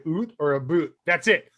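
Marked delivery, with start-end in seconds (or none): 0.1-0.3 "oot", Canadian vowel for out; Canadian accent. Acted. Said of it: "if you hear him say". 0.5-0.9 "a boot", Canadian vowel for about; Canadian accent. Acted. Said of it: "somebody from Canada".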